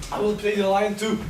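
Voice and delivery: excited, pushing to be cast; eager boast. Acted. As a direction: grab the part eagerly. Bottom eager for lion part.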